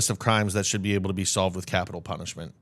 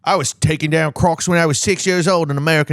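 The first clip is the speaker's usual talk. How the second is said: loud, higher Aussie slipping into Cockney; botched Australian accent, pitched up and loud; mangled Australian accent; 6 semitones higher, 6 dB louder than usual. Acted. Aussie bragging about crocs, mangled Australian accent. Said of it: "what the fuck that accent turned into".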